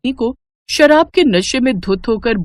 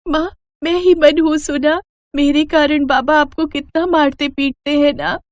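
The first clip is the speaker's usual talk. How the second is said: put-on sad child voice; 8 semitones higher than usual.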